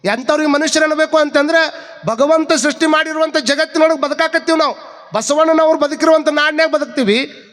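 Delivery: high-pitched, driving sermon delivery; impassioned preaching. Real, not acted. Preacher exhorting listeners on Basavanna's teaching, impassioned and high. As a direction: preach it insistently, voice held high.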